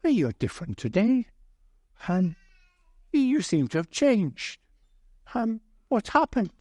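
a friend amazed at the change, voice lifted; curious friend. Retold or imitated. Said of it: "a friend went on to him and said".

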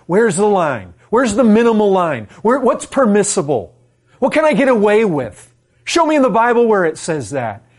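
loud, high mock-indignant demands; demanding legalist. Preacher mimicking people who ask what's permissible, loud demanding. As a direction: shout it like someone insisting on loopholes. People that are always asking.

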